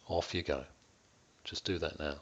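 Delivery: ordinary delivery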